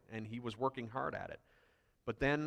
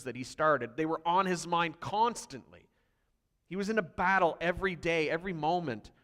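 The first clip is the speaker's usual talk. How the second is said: louder, higher, hammering each fought; preaching at full force; 5 semitones higher, 6 dB louder than usual. Acted. A pastor rousing his church about Paul's battles, fervent and loud. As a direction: pound the refrain like a call to battle.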